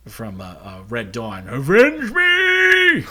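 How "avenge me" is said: high, slow, strained dying cry; Harry Dean Stanton. Retold or imitated. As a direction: drag out a loud anguished plea. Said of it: "shittiest Harry Dean Stanton impression".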